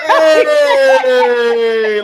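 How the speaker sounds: long, high, loud "hey" cheer; excited whoop. Real, not acted. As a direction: whoop it out and hold it. Cheering the reveal of the bottle.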